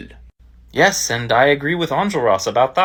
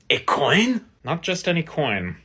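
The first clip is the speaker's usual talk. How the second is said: ordinary talk, nothing marked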